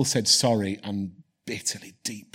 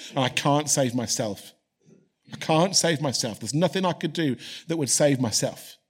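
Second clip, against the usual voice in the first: loud, rising preacher's call, pressing each phrase; fervent preaching; 4 semitones higher, 4 dB louder than usual. Acted. Pastor urging his church to salvation, in loud fervent preaching. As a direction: preach it out with full force to the congregation. Preacher building to full force.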